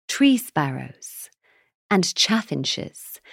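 slow, deliberate listing; pedantic listing. Acted. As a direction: spell out each bird slowly. Sigurd listing birds pedantically.

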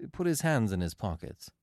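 plain reading voice

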